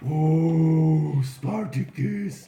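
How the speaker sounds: slow, drawn-out reverence; hammed-up kingly admiration; awed dragon king. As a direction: savor the name grandly. King savors the hero's name.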